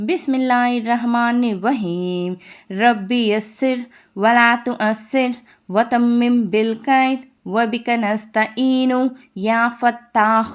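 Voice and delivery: slow, measured dua recitation; recited prayer. Acted. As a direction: recite the opening prayer devoutly, slowly. (నెమ్మదిగా దువా పఠనం)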